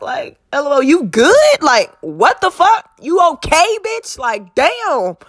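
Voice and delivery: high, rising, hammed-up incredulity; exaggerated disbelief. Mock-checking on sister after her text, exaggerated disbelief. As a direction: act out a swooping, high-pitched are-you-serious reaction.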